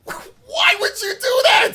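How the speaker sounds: very high, slow, loud mock protest; outraged. Streamer reacting to the villain kneeing a dog. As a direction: stretch it out in shocked outrage. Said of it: "I'm like".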